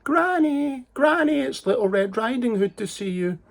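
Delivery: high, sweet calling voice; little girl calling. Acted. Girl calls to Granny at the door, high and sweet. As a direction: call gently through the door like a child.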